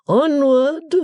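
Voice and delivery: high, loud stately matron command; Mrs Wilfer's order. Acted. Stately mother orders the servant onward, imperious. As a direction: proclaim it like a grand lady. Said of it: "received in the vehicle by Missus Wilfer".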